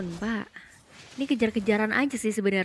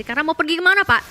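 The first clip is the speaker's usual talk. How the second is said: excited; 6 semitones higher, 8 dB louder, faster than usual. Acted. Caster reacts to a trapped hero.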